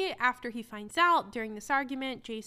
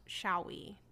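ordinary talk, nothing marked

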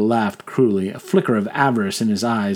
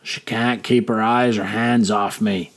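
usual speaking voice

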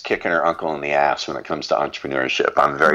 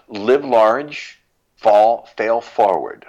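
louder, higher, punchy motto; rallying motto; 6 semitones higher, 4 dB louder, much slower than usual. Real, not acted. Parting motto to listeners, louder and higher. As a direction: call it out like a slogan. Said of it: "any final words".

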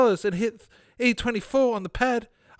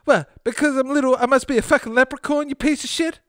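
angry little man; 9 semitones higher than usual. Acted. Offended little man snaps back at Fred, high and angry. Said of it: "he continued".

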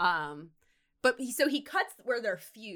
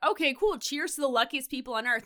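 cheery toast; 6 semitones higher, 4 dB louder than usual. Retold or imitated. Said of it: "Philip is like".

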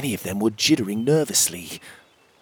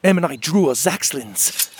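usual speaking voice

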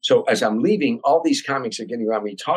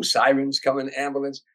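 ordinary delivery

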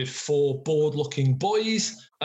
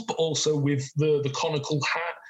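ordinary delivery

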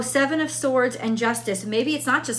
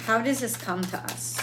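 softer, murmured repeat of the question; quiet; 6 dB quieter than usual. Shown as a demonstration. Reader re-asking the tarot cards privately, murmured. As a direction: ask it again under her breath. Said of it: "Let's ask that question".

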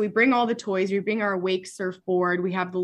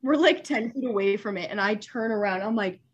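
ordinary talk, nothing marked

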